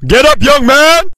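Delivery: normal talking voice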